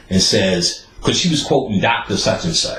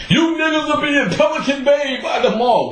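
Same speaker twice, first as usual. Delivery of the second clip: much higher mock-dramatic warning; dramatic aunt. Aunt predicting jail at a funeral, much higher, mocking. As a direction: ham up the aunt's doom prophecy. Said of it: "waiting for the violins to start playing".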